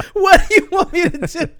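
high, loud squawk through laughter; exasperated mock protest. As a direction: protest loudly, cracking up. Acted protest in quarrel with mother.

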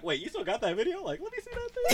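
high, laughing, eager ask; eager request. Retold or imitated. Friend asks Greg to see the old trip video, high and eager. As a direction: beg to see the clip, laughing.

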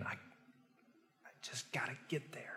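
slow, breathless whisper; a tired rower, whispered and slow; strained whisper. Retold or imitated. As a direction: grit it out under your breath. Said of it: "You keep thinking".

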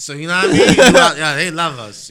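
loud, high and laughing; laughing hype. Real, not acted. Bragging to his friends, loud and laughing. Laughing about New York fans.

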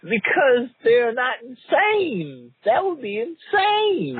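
high, slow, laughing protest; laughing at a friend's purge idea; laughing disbelief. Real, not acted.

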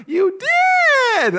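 very high, loud squeal of false delight; fake-happy high voice. Faking delight at a friend's new car, high voice. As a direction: shriek congratulations you don't mean. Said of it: "Why am I saying high voice?".

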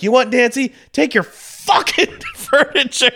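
high, loud, furious retold yell; yelling at the neighbour in a rage, with a laugh breaking through; heated yelling. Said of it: "I got real heated with her".

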